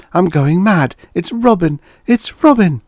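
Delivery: rising shriek of terror; terrified. A narrator's reading. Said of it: "in a sudden spasm of terror".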